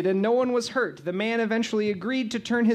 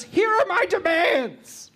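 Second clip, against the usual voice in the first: dramatic announcement; 9 semitones higher, slower than usual. Acted.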